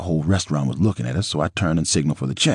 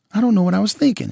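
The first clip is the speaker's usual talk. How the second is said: Nicole's voice; 4 dB louder, faster than usual. A narrator's reading. Nicole voiced in retold quarrel.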